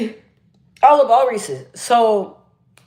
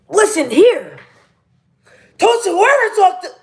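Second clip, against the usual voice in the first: much higher, projected brotherly scolding; chest-voice scolding; 9 semitones higher, 5 dB louder than usual. Retold or imitated. Big brother scolding someone during an argument, projected from the chest. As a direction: boom it out like an angry big brother. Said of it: "when you project from the chest".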